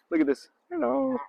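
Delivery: a delighted burst of surprise at the birds; excited. Real, not acted. Excited at ducks close by.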